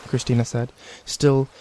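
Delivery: ordinary delivery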